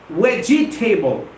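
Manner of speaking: "Vegetable" drawn out as vej-ee-TAY-bul; mispronounced vegetable. Shown as a demonstration. Teacher repeating the error, "Vegetable" wrongly as vej-ee-table. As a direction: sound every syllable of "Vegetable", ending like table. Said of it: "it's not vegetable".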